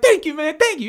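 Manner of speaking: high, loud thankful; grateful guy voice. Retold or imitated. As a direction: gush thanks like a relieved guy. Grateful passenger quoted.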